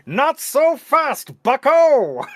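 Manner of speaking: slow, high mock announcer drawl; old-timey newsreel voice. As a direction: stretch it out like a forties newsreel.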